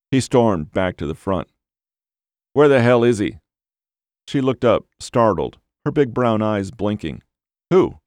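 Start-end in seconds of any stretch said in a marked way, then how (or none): 2.5-3.4 angry demand. Acted. Storming in, demanding answers. 7.7-8.0 startled. Acted. Startled receptionist looks up.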